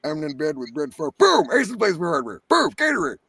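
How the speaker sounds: hammed-up voice that leaps up on the booms; starting character voice. Shown as a demonstration. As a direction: put on a high, punchy character voice. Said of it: "So I started with".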